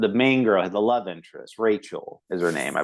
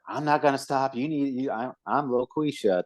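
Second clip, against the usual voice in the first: lightly voiced, higher sassy Loquisha; a hint of a put-on Loquisha voice talking back; mild mock Loquisha retort; 5 semitones higher than usual. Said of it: "She's like".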